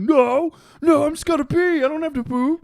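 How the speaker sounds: high, loud, frantic mock denial; protesting kid. Acting embarrassed kid protesting.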